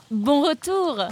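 la maman, voix haute et douce; mother. A narrator's reading. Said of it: "dit la maman de Nemrod".

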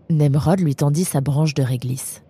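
usual speaking voice

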